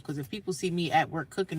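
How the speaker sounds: normal talking voice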